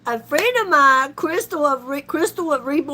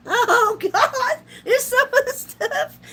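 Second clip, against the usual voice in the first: higher, breathless with laughter; laughing; 9 semitones higher than usual. Real, not acted. Recovering from laughing at chat, still laughing. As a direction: catch your breath between laughs. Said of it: "it kinda hurts to laugh".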